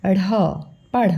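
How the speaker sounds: ordinary talk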